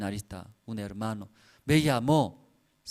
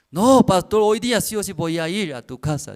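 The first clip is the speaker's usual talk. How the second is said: put-on loud, high pleading yell of a drunk man; drunk insistent shout; 7 semitones higher, 9 dB louder than usual. Drunk brother shouting down the phone at the pastor, loud and insistent. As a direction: bellow it like a tipsy man who won't take no.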